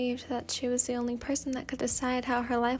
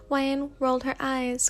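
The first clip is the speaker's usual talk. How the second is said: usual speaking voice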